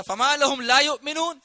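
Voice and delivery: loud, high, impassioned challenge; fiery preaching. Real, not acted. Preacher asks why they still disbelieve, fiery and loud. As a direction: thunder the question at the crowd.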